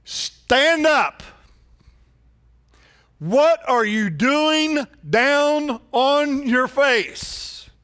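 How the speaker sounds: slow, booming divine command; God's rebuke. Acted. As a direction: command him to get up, slow and firm. God rebukes Joshua.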